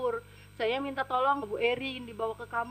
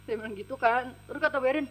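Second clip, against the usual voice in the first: usual speaking voice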